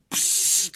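sharp, voiceless hiss; hissing psst. A narrator's reading. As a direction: let out a long hiss. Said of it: "udělal maličký". (syčivé psst)

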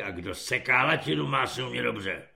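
low, soft corporal's grumble; grumbling. A narrator's reading. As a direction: grumble the maxim low, under your breath. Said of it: "zabručí". (tiché hluboké bručení)

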